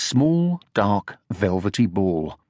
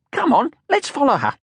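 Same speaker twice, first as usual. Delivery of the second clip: excited. A narrator's reading. Sniff eager.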